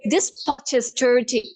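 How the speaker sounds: slow British accent, hard T in "dirty". Shown as a demonstration. Teacher converts pot is dirty, British accent. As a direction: hit every T crisply, British. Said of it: "how do you say in British".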